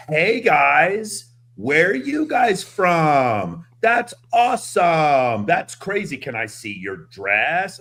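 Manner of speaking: mock a guy overeager with a girl; imitating a client chatting up girls on video; nervous gushing guy.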